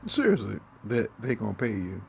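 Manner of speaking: you can't believe them, let it fly; indignant outburst; exasperated. Real, not acted. Scolding rant, disbelief.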